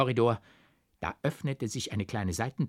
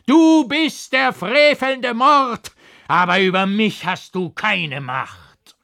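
loud, high, slowed accusation; frantic shouting; 10 semitones higher, 9 dB louder, slower than usual. A narrator's reading. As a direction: scream at the devil, out of your mind. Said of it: "schrie ich außer mir".

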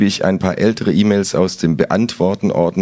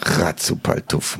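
Ratzupaltuff murmured much softer and lower, slow, half whispered; 7 semitones lower, 7 dB quieter, slower than usual. A narrator's reading. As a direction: mutter Ratzupaltuff under the breath, slowly. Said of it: "murmel ich".

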